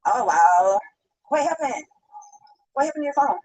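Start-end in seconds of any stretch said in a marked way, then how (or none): none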